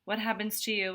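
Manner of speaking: ordinary delivery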